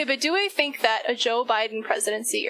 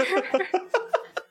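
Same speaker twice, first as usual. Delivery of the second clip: laugh; 7 semitones higher than usual. Real, not acted. Laughs at the argument.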